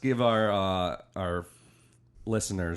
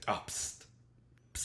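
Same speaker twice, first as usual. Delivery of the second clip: imitating; 12 dB quieter than usual. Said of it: "is always like".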